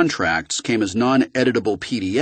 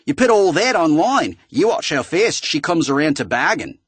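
higher, bad Australian synthetic voice; laying it out in a crappy Australian accent; an Australian computer voice pushing Marcus to leak; 8 semitones higher than usual. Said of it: "the Australian voice said again".

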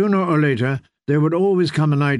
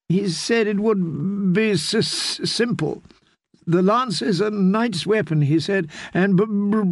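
high, slow, stuttering shaken noblewoman; a battered wife stuttering through tears; stammering distraught lady; 7 semitones higher than usual. Acted. Distraught Felice stammers her tale.

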